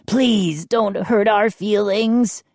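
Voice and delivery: high, slow rasping gnome plea. A narrator's reading. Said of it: "Chip rasped".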